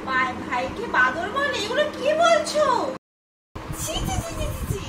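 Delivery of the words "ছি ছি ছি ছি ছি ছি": scandalised tut. Acted. Teacher scandalised by rude answer.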